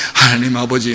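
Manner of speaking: low, soft mock-prayer murmur; imitated prayer. Motorcyclist suddenly moved to pray, imitated quiet prayer. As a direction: drop down into a quiet acted prayer. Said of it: "기도하죠".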